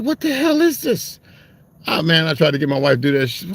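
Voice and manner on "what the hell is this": high, slow, amazed what-the-hell; a man floored by something new; stunned disbelief. Retold or imitated. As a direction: gawk at it in total disbelief. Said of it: "I was like".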